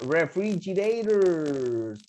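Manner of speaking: "refrigerators" drawn out for learners; pronunciation model. Shown as a demonstration. Teacher introducing a hard word for students to pronounce, stretched out. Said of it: "¿Podrán pronunciar ustedes esa palabra?".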